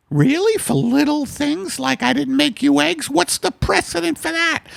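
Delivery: a shrill-free falsetto Margaret, bossy; high put-on wife voice. Husband teased by his wife, in a high falsetto wife voice.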